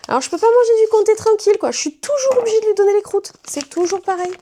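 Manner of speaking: high, fast laughing whine; half-laughing, half-whining grumble; whiny laughing complaint. Real, not acted. Complaining to dog at her feet.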